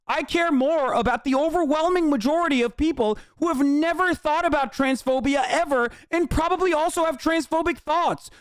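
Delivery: ordinary talk, nothing marked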